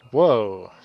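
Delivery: loud, startled exclamation; big acted whoa; startled villager. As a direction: blurt it out loudly in sudden surprise. Voicing startled game character.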